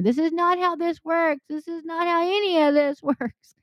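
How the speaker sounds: high mock exasperated voice, breaking into a laugh; meme quote. Preacher quoting a Facebook meme in a high, laughing voice. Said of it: "it's like that Facebook thing".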